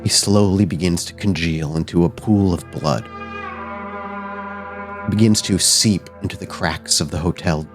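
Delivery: measured, suspenseful narration with long pauses; game master's scene setting. A narrator's reading. Game master describes a spirit melting away, hushed suspenseful narration. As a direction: let each image land before the next.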